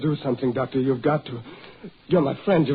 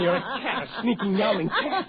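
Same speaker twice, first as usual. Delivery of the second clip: jeering through a laugh; laughing taunt; 4 semitones higher, 4 dB quieter than usual. Acted. Husband mocking wife, laughing taunt. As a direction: sneer it with a giggle.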